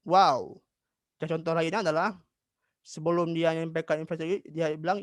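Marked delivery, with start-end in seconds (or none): 0.1-0.6 short, louder mock-surprised burst; surprised exclamation. Shown as a demonstration. Teacher explaining what the wow shows, demonstrated surprise. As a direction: exclaim it as a quick example. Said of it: "di mana dia terkejut".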